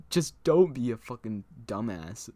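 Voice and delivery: loud mock scolding, laugh right after; retold telling-off, punched out loud; exasperated retort. Prankster answers his angry friend, loud exasperated retort.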